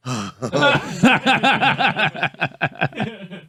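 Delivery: long, high cackling fit; laughing. Real, not acted. Host cracks up over a silly name, laughing hard.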